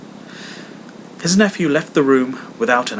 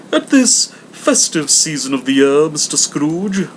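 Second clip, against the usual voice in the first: a courteous charity collector; hammed-up polite visitor; portly gentleman; 5 semitones higher, 7 dB louder than usual.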